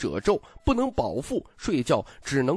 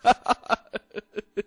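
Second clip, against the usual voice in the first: higher, rapid ha-ha burst dying away; hearty laugh; 7 semitones higher, 4 dB louder than usual. A narrator's reading. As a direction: laugh out loud, trailing off. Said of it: "笑道".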